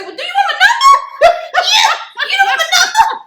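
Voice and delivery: high, loud, laughing demand; indignant mock shout. Storyteller mock shouting at the man who paid, laughing. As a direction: shout it at him half joking. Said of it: "I wanted to go back and say".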